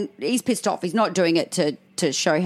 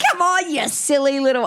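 laughing mock scold; 8 semitones higher than usual. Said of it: "started laughing and gone".

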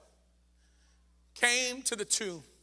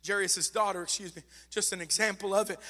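ordinary talk

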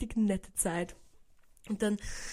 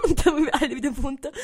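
repeated with a laugh, a little higher and louder; laughing through it; 4 semitones higher, 5 dB louder, faster than usual. Real, not acted. To viewers, retelling waking up, laughing. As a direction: giggle while saying it again.

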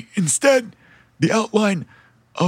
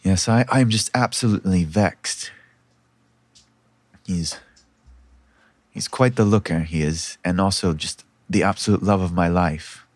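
vexed princess, very low in pitch; low, halting lovelorn confiding; Myra; 12 semitones lower than usual. Acted. Princess telling a stranger about her missing betrothed, vexed and lovelorn. Game master voices Myra.

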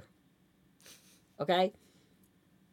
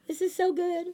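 grandmother gushing; 4 dB louder than usual. Retold or imitated. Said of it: "she was just sitting there going".

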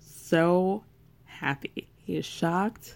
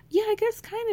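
high shy reply; faster than usual. Retold or imitated. Voicing Amani's shy answer.

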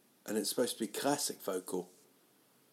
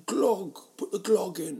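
mock safe word; 10 semitones higher, 4 dB louder than usual. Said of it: "Be like".

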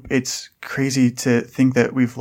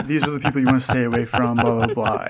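rattling off; faster than usual. Acted. Sasha rattling off rules.

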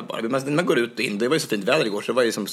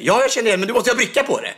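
high, loud insistent retort; exasperated guard; 12 semitones higher, 5 dB louder, faster than usual. Retold or imitated. Guard insisting on a badge from someone he knows, exasperated. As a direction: argue back loudly, pitched right up. Retold guard arguing back.

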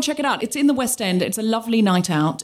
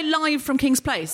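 announcer intro; 5 semitones higher, slower than usual. Shown as a demonstration. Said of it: "when I say".

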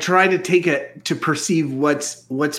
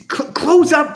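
disgusted plea; 11 semitones higher, 4 dB louder than usual. Acted. Repeated plea.

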